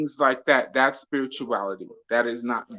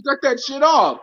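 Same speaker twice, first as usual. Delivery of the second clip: high, loud retold command; retold yell; 10 semitones higher, 5 dB louder than usual. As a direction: snap the order, high and loud. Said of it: "I was like".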